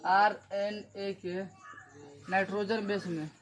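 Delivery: slow, word-by-word dictation with pauses; spaced out slowly for copying. Real, not acted. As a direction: dictate it piece by piece for note-takers. Repeats slowly for students writing notes.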